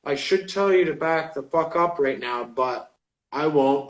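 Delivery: low, quiet muttered grievance; frustrated inner thought. Retold or imitated. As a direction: keep the frustration under your breath. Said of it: "in my head, frustrated, like".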